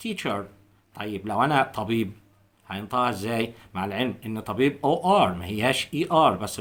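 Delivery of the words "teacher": teacher in an American accent, with a clear r at the end. Shown as a demonstration. Teacher demonstrates American teacher to learners. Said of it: "الأمريكان accent هننطقها teacher".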